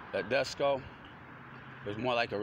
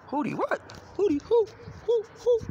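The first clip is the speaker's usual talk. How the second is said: high, rising hoo calls, much louder at the end; owl hoot; 5 dB louder than usual. Pretend. Riffing on owls, hooting in a high mock owl voice.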